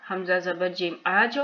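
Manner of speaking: आज ending in a tiny echo after the j; qalqala bounce. Shown as a demonstration. Teacher repeating for learners, आज with a rebounding j. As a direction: bounce the last consonant of आज. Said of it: "फिर कलकला".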